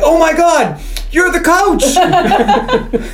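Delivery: high, drawn-out starstruck gasp of words; hammed-up amazed fans, breaking into laughter; freaking out. Kids suddenly recognise the coach, freaking out.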